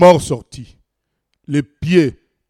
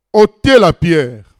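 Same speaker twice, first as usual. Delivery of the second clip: commanding; 8 dB louder, slower than usual. A narrator's reading. Jesus commands, read aloud.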